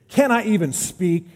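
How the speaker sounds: hammed-up sulky protest; whiny little brother. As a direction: plead like the picked-on kid. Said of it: "angry little brother, I've been abused response".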